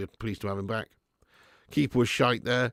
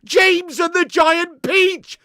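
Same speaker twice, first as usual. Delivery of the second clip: high, slow, shouted commentator call; hammed-up football commentary roar; mock goal commentary; 9 dB louder, much slower than usual. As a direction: bellow it like a commentator on a screamer. Repeats pun, extends it.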